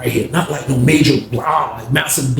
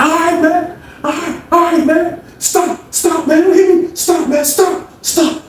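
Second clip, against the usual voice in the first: imitating a scared pleading man; 13 semitones higher, slower than usual. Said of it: "He's like".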